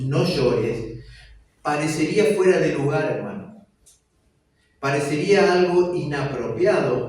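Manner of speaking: fervent preaching. Acted. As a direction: drive each phrase home to the congregation. Preaching at full force. (predicación fervorosa y lenta)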